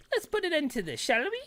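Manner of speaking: high, playful game character; chirpy cartoon sidekick lines. Reads party lines in voices.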